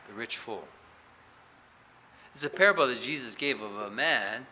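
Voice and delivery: normal talking voice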